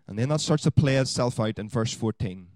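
ordinary delivery

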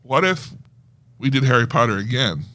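normal talking voice

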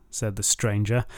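plain reading voice

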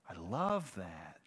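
soft, low, lingering on the words; hushed appreciation. Real, not acted. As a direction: savour it slowly under your voice. Savouring the line just read.

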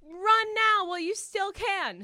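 high, slow, loud melodramatic urging; hammed-up movie-style warning; dramatic warning. Dreamer retells warning the thieves to flee, hammed-up and urgent.

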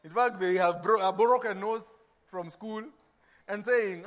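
usual speaking voice

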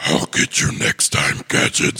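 low, raspy villain growl; Doctor Claw. Acted. Co-host recalls a cartoon villain's line for his friend, raspy villain voice. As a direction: rasp it out as a menacing cartoon villain. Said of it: "Doctor Claw like the".

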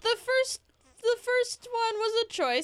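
put-on high, slow old grandma voice.

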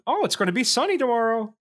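high chirpy mock weather app. Mocking the phone's weather app, high cheery voice.

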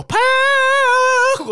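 high, drawn-out mimicked shriek; imitated scream. Mimicking Timon screaming in Per's high voice, shrieking. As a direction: shriek the name in a light voice. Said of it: "och skriker".

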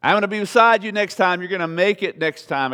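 loud, high pep talk; encouraging cheer. Acted. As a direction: cheer them on, bright and big. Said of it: "the gift of exhortation comes along and says".

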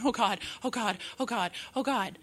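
quick, hushed mock fretting; mild panic. Worrier about to buy a house jobless, mild retold panic.

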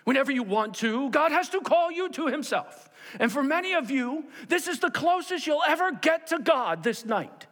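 loud, high, urgent evangelist voice; Billy Graham. Retold or imitated. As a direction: imitate a crusade preacher pressing the crowd. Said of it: "sense of urgency in his voice".

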